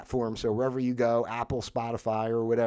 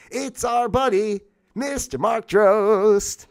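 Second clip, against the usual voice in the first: mock announcer; 8 semitones higher, 5 dB louder, much slower than usual. Mock grand introduction of friend.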